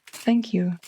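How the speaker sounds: ordinary delivery, nothing marked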